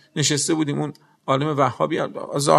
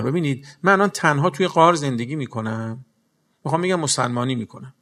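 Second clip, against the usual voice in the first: normal talking voice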